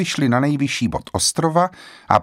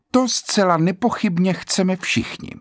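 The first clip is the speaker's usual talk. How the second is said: dry; 8 semitones higher than usual. A narrator's reading. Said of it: "řekla suše".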